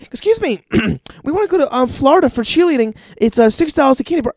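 put-on high, sing-song pitch of pushy kids; mock fundraiser kids. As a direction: mimic the cheerleaders asking sweetly. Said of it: "they're like".

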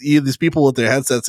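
ordinary talk

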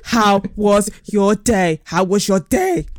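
slow mocking repeat; imitating his tone. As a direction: drag it out the way he said it. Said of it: "I said".

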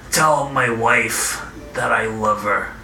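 soft, slow dying words; wounded soldier gasping. Acted. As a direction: fade out on the last breath.

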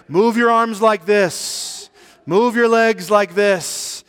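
slow, stately loud call, drawn out; dignified yell. Retold or imitated. Buddha calls to drowning man, dignified slow yell. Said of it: "yelled out to me in a very dignified manner".

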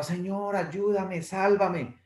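high, pleading shout from the water; desperate cry for help. Acted. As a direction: beg God to save you, voice up high. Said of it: "en el mar gritaba". (grito desesperado pidiendo ayuda)

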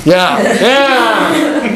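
high giggling yeah yeah; laughing. Real, not acted. Teacher laughing with the class, a giggling yeah.

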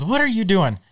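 lightly voiced shocked wife; a hint of a scolding wife; quoting Lois. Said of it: "Lois goes".

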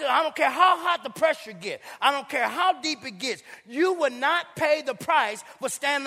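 loud, climbing pulpit shout; full-force whooping sermon; preaching at full force. Acted. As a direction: hammer the point home. Preacher driving home point.

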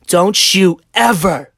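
loud, high, slow mock tirade; angry New Yorker. Man imitates wife warning husband about kids, angry yelling. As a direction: mimic a New York wife yelling each word. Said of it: "she's a New Yorker, remember that".

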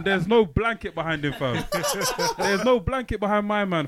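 loud laughing teasing. Real, not acted. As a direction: crack up while teasing. Teasing laughter about the setup.

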